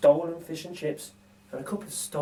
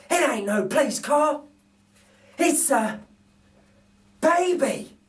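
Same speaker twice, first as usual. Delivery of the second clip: astonished burglar; 11 semitones higher, slower than usual. Acted. Narrator voices Bill discovering a baby, hammed-up surprise.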